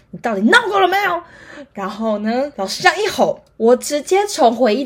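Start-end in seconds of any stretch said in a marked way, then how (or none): 0.1-1.2 high, loud, fast scolding yell; furious teacher yelling at the class; teacher's yell. Retold or imitated. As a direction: shout it at the student. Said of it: "就大声的跟他说".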